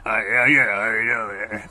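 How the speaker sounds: low, rough smoker rasp; like a thirty-year smoker; fake smoker's voice. Shown as a demonstration. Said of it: "not putting on a fake, like, phony".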